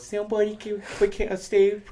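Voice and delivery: mock news anchor; reporter voice. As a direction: announce it like a reporter. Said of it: "that's my reporter voice".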